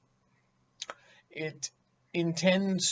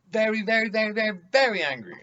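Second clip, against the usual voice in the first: mock escalating very; 8 semitones higher, 8 dB louder, faster than usual. Mocking speaker piling up very.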